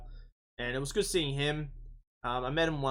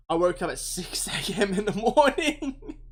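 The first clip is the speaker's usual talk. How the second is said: laughing through the words, a bit louder and higher; 8 semitones higher, 6 dB louder than usual. Real, not acted. Telling a friend about the early start, laughing. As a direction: chuckle while telling how early you got up.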